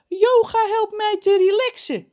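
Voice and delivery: high, loud character voice; story character John. A narrator's reading. John answers from behind the bar, high and loud.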